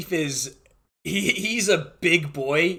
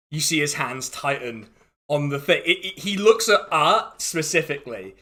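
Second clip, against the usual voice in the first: ordinary talk, nothing marked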